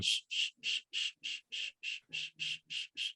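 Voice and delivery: gentle unvoiced shushing to lull a child; soft shushing. Shown as a demonstration. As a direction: shush softly like putting a baby to sleep. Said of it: "I'll be going".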